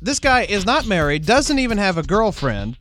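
ordinary talk

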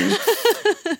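breaking on the word; steadying shaky voice. Real, not acted. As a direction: voice cracking high. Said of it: "I'm getting a little emotional here".

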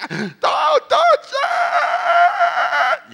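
put-on very high, loud, drawn-out furious yell at the kids; mother snapping. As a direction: let the exhausted mother finally explode. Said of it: "she can't take it anymore".

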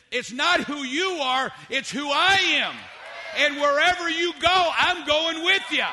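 emphatic divine assurance. Acted. Preacher voices God's answer to hesitant Moses, emphatic assurance.

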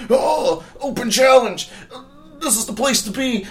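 high shouted wrestler promo. Retold or imitated. Quoting the wrestler's entrance to his friend, a high shouted promo. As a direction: hype the crowd like a wrestler on the mic. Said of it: "and he goes".

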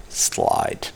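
breathy whispered slide; just breathe the word out. Shown as a demonstration. Murmuring his mantra to himself in a whisper. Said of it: "Just slide".

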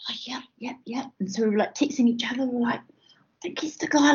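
hushed conspiratorial whisper; mock whisper. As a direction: whisper it like a secret at the table. Whispering texts between producers.

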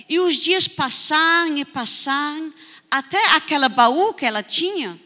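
ordinary delivery

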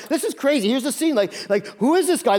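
high, amazed disbelief; astonished crowd. Retold or imitated. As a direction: voice the stunned onlookers.

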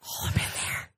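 voiceless whispered aside; whispering. Real, not acted. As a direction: whisper it under your breath.

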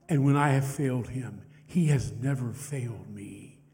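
softly voiced testimony with long pauses; hushed, reverent retelling; retold dying veteran. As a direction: quote a dying man's faith, gently and haltingly. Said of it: "he can barely whisper".

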